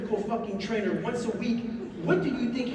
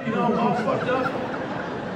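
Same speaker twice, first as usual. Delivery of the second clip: normal talking voice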